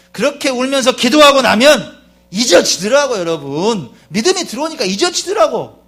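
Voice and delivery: very loud, high revivalist delivery; impassioned preaching. Real, not acted. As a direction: exhort the congregation, building to a shouted prayer. Preaching rises into shouted prayer.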